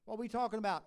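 ordinary talk, nothing marked

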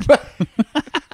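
high burst of laughter; a real laugh at his own story; high cackle. Real, not acted. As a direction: crack up high.

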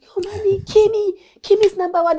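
high-pitched imitation of a little boy; excited child. Mother voices her excited young son.

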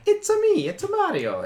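squeaky falsetto Mario, very high at the start; Charles Martinet. Acted. Showing Mario's voice of today, high sing-song chirp. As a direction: chirp it like the cartoon plumber. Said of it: "since Martinet has been doing it".

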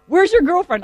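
insistent mock police questioning; police demand. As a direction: play an officer demanding answers. Police questioning a suspect, acted.

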